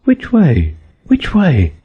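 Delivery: high, anxious repeated question. A narrator's reading. Alice anxiously feeling her head, high and worried. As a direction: fret as you check. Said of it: "said anxiously".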